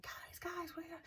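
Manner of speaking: hushed, high imitation of calling out; mock yell. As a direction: call out high but softly. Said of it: "yelling for them".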